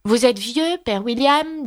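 high-pitched, lilting recitation; reciting a poem. A narrator's reading. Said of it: "joignit les mains et commença". (récitation, voix aiguë)

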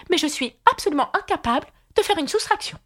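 high, rapid queen voice. A narrator's reading. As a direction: blurt it high and fast.

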